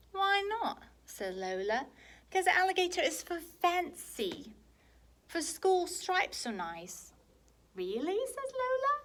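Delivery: put-on high, bright childish voice; little girl voice. Lola questioning her brother, high little-girl voice. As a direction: play the little sister, high.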